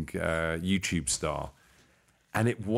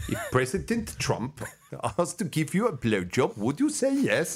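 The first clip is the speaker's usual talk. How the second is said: higher put-on voice; interviewer character; 6 semitones higher than usual. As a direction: ask in a higher comic voice. Quoting character's question.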